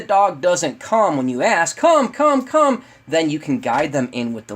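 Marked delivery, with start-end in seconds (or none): 1.8-2.8 high, loud repeated recall call; eager, punchy dog recall. Shown as a demonstration. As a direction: call the dog in urgently, voice lifted. Acts calling the dog to come.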